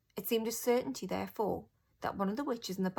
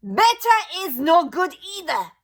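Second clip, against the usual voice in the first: high, loud witch shriek; Grand High Witch rejecting the witches' promise; 10 semitones higher, 14 dB louder than usual. A narrator's reading. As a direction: cut them off with a shriek. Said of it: "shrieked the Grand High Witch".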